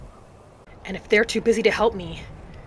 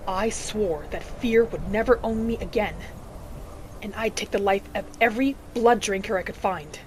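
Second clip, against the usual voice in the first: normal talking voice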